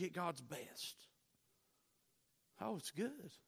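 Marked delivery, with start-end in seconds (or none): none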